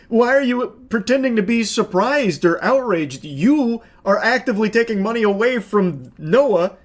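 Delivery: usual speaking voice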